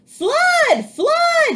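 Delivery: very high, loud alarm cry; cat shouting alarm. A narrator's reading. Jack yells at the birds, very high and loud. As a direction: yell a warning to scare them. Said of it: "he cried".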